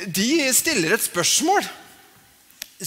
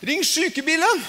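injured person pleading; 7 semitones higher than usual. Retold or imitated.

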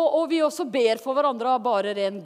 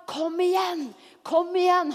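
higher-pitched, eager coaxing; urging encouragement; 5 semitones higher than usual. Acted. The Holy Spirit urging a believer on, eager encouragement. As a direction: urge them on energetically. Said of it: "Han er en gentleman".

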